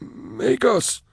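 a man who has forgotten how to speak; broken prisoner voice. Acted. Caged prisoner pleading to be recognised, high halting voice. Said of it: "with an anxious nod".

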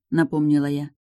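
plain reading voice

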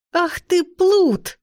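high-pitched mock-outraged yell; indignant cry. A narrator's reading. Heroine to teasing friend as she thumps his chest, indignant cry. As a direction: cry out, pitch leaping well up. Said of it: "закричала я и стукнула его".

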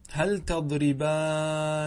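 "تضربانن" with every n sounded, long aa; slow teacher's demo of "تضربانن", ending -aaninna; original form. Shown as a demonstration. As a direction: draw out "تضربانن" as tadribaaa-ninna. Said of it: "والأصل".

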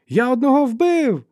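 very high, loud, drawn-out cry; excited shout. A narrator's reading. Young fighter announces his kill to comrades, shouting. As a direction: yell out the kill at full voice. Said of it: "вигукнув він".

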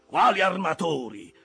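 high, loud angry question; scornful challenge. A narrator's reading. Ahab dismisses the owners' authority, loud scorn. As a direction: throw the word back with contempt.

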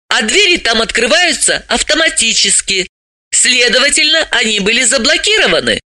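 high-pitched, brisk explainer; high character voice, instructive; animated female character, businesslike. Acted. Voices Dalila reporting the case.

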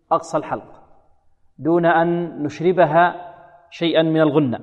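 ordinary delivery

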